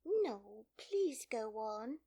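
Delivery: high, slow entreaty; earnest, apologetic plea; humble. A narrator's reading. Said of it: "Alice said very humbly".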